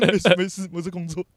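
high, loud voice straining to sound fine through tears; tearful "I'm fine, I'm at work"; choked reassurance. Retold or imitated. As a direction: insist you're fine while about to cry. Holding back tears on phone.

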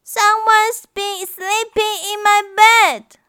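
put-on high, loud baby bear wail. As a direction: wail it like a small upset bear. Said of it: "wailed Baby Bears".

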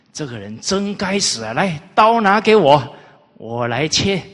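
mock outrage. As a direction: demand the knife with hammed-up fury. Minister feigns agreement, demands knife. (假装义愤，大声要刀)

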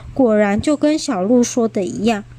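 usual speaking voice

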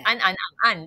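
安、安、安、安 said once in each Mandarin tone, first to fourth; 安 in all four tones. Shown as a demonstration. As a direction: run 安 through tones one, two, three and four. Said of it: "国语不是会有四声吗".

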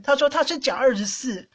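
ordinary delivery

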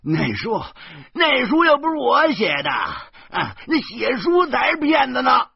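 very high, loud, indignant shout; yelling protest. Acted. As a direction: shout back to shift the blame. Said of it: "小贩儿叫喊着说". (尖声叫喊着辩解)

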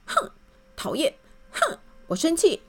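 high, pouty angry huffing; grumpy huff. Acted. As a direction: sulk and huff. Grumpy man's catchphrase.

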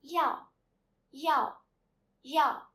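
"Yao" very loud, high, falling steeply; fourth tone. Shown as a demonstration. Teacher drilling students to repeat, fourth tone on "Yao". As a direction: punch each "Yao" separately, pitch dropping fast. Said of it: "Repeat after me for two times".

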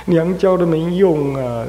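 mildly weeping over an unfilial son; mother's lament. Retold or imitated. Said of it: "他妈就哭啊".

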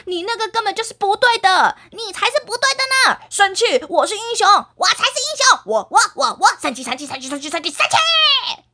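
put-on high, fast, childish angry squabble; 吵架发火. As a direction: stamp and shout like a sulking hero. 两个英雄吵架. (孩子气地生气大叫)

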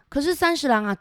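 usual speaking voice

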